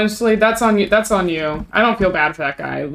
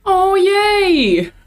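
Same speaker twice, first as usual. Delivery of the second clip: high, bright squeal of delight; a gleeful little squeal; excited cheer; 12 semitones higher, 3 dB louder than usual. Real, not acted. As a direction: cheer like you just got great news. Cheering a viewer's Nanoleafs.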